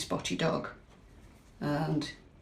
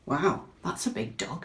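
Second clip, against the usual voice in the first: plain reading voice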